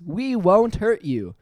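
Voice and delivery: slow, high, carefully enunciated call; high and measured, each word distinct; loud, clear voice. A narrator's reading. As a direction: project it slowly to a listener who may not understand. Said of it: "he said in a loud, clear voice".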